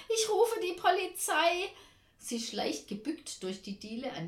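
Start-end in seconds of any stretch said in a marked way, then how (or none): 0.1-1.7 high, loud, wailing threat; panicked wail. A narrator's reading. Aunt threatens to phone for help, wailing in fright. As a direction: lament it in rising panic. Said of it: "jammert Tante Ernie".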